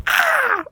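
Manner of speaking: high loud yelp of pain; startled scream. Retold or imitated. [name] retells getting punched, a startled loud scream. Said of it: "she was like".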